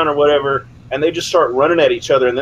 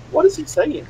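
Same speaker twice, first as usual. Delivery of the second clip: retold puzzlement; 13 semitones higher than usual. Retold puzzlement at teammate yelling.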